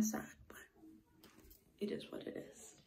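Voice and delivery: hushed whisper for privacy. Real, not acted. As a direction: keep it down so nobody hears. Whispering in the bathroom.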